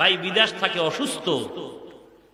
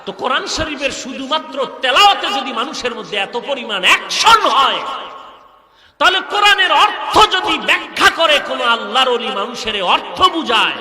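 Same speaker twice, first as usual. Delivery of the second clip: loud, raised preacher's argument; forceful preaching; 6 semitones higher, 8 dB louder than usual. Real, not acted. Preacher arguing the Quran's effect on listeners, forceful preaching. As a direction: drive the point at the crowd with loud peaks.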